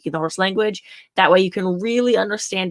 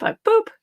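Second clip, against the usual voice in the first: boop. Shown as a demonstration. Demonstrating the boop correction.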